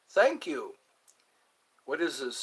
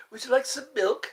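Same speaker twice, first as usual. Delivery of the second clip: kitten voice; 6 semitones higher, faster than usual. Pretend. Offering milk in a kitten voice as asked. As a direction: ask like a tiny kitten. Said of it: "Try to sound like a kitten".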